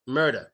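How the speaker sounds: "murder" said as "mur-da". Shown as a demonstration. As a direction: end "murder" on an open A. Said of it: "We pronounce the E R as an A".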